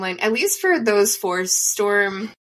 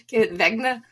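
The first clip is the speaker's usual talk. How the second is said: faux German accent; 2 semitones higher, slower than usual. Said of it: "says it, like, eight times".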